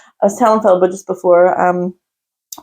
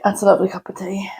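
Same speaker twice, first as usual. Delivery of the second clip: whispering; 6 dB quieter than usual. Real, not acted. Whispered aside about tea.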